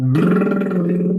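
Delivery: buzzing lip trill. Shown as a demonstration. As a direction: trill the lips on a brr. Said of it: "I'm gonna say the words".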